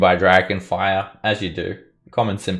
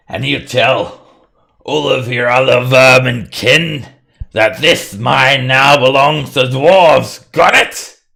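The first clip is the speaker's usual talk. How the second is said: loud, raised, commanding shout; threatening dwarf; 5 semitones higher, 10 dB louder, slower than usual. Acted. Dwarf warning the kobold off the mine, threatening shout. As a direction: lay down the law at full volume.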